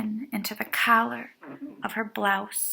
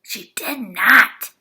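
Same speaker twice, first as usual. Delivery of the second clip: whispered; 9 dB louder than usual. A narrator's reading. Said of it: "whispered Angela".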